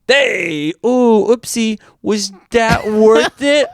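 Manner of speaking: high, slow taunting voice to a bug; mock taunt at a moth. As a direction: slowly gloat over the squashed moth in a high voice.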